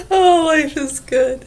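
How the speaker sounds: laughing through the words; real giggle breaking into the line; breathless laugh while speaking. Real, not acted. Laughing at her own story.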